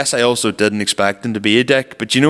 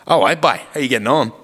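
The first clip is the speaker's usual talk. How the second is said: lightly put-on Tyrone accent, raised; a hint of a Dungannon local greeting; Tyrone accent impression; 3 semitones higher than usual. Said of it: "That sounded more Australian".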